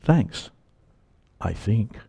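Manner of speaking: a hint of a quiet, uncertain mutter; deflated thanks. Pastor after reading the rebuke, quiet and deflated. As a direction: let it sag, softly unsure.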